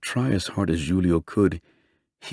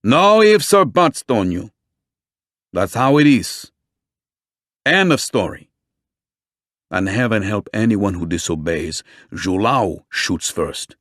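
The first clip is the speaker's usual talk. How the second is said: stern police chief. A narrator's reading. Chief cutting off protest firmly.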